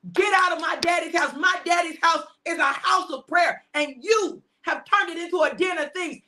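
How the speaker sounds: rising angry shout at the traders; Jesus bellowing in the temple; furious Jesus. Acted. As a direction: drive them out with fury. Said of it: "he said".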